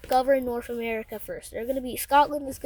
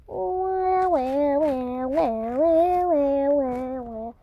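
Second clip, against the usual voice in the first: mock crying like Iron Mouse; much slower than usual. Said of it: "they're crying, like Iron Mouse".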